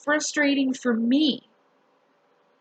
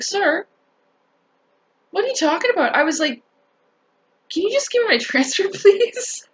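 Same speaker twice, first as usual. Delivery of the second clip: high, incredulous question through laughter; laughing disbelief; 7 semitones higher than usual. Shown as a demonstration. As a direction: laugh at how absurd it is, pitch climbing on please. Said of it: "that's how I did it. I just started laughing like that".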